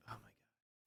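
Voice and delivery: whispered mock-astonished aside; hushed reaction. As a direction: breathe it out under your breath. Mimics own hushed reaction at film.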